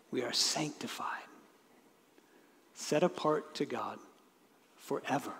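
whispered, almost breathless; hushed awe. Real, not acted. Preacher whispering the truth to his congregation, hushed. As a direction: let it drop to a reverent whisper.

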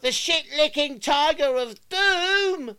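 high, loud disbelief; incredulous. Acted. Incredulous repeat.

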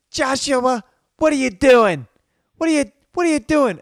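high, loud mock maternal shriek; upset mom. Mom catching naked son before guests, shrieking in mock upset. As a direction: imitate a scandalized mother scolding. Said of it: "his mom gets so upset".

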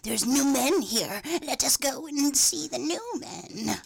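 slow, cackling witch voice; lightly voiced witch. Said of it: "It's a solid witch voice".

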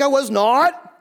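loud, high protest; indignant denial. Acted. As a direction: deny it loudly, voice climbing. Acting Peter's indignant denial.

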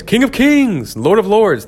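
high, ringing herald's cry; grand proclamation. Acted. Proclaiming a grand title as example.

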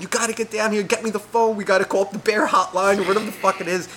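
high-pitched frantic yell, held back in volume; retold maniac yelling. Storyteller calling upstairs about bears, mock maniac yelling. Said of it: "I'm yelling like a maniac".

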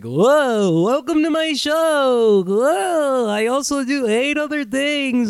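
high, drawn-out mock host voice; mock a phony show host with a silly high voice; put-on podcaster voice. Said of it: "who fucking puts on a voice".

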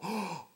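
mock audience gasp; sharp, quiet intake of shock. Shown as a demonstration. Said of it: "I didn't see anyone go".

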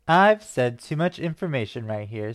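usual speaking voice

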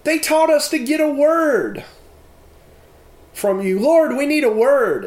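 retold daughter calling out to the family; daughter's voice. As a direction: speak as the nine-year-old daughter, urgent. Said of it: "she says something to the effect".

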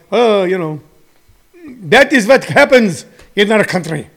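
put-on, shaky-attempt Russian accent. Comedian voicing Russians shrugging off vaccine deaths, attempted Russian accent. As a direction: try a rough Russian accent. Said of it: "I could do a Russian accent".

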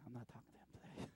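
fast, whispered and fearful; scared whisper. Pretend. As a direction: mutter it like a frightened disciple. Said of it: "I can just imagine they're like".

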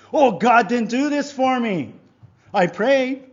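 high, loud grievance, acted out; indignant complaint. Disappointed believer complains that God didn't do it, indignant. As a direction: protest like a wronged believer.

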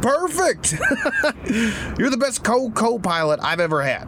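very high excited praise with laugh; delighted. Acted. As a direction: burst out with delight. Dad thrilled at son's try.

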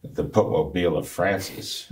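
ordinary talk, nothing marked

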